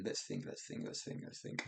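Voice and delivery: let's think whispered to herself. Real, not acted. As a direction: mutter it under your breath while searching. Thinking aloud under breath.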